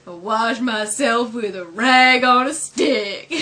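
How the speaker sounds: slow, loud put-on cartoon voice; hammed-up cartoon character voice; Bart. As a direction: drawl it out loudly. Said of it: "when Bart's like".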